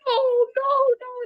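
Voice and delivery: high, loud, panicked wail of no; imitating a distraught cry. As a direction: retell her breaking down in a high cry. Coworker breaks down crying.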